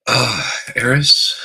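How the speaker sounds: slow, loud welcoming call; drawn-out greeting. Real, not acted. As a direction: stretch the name out warmly.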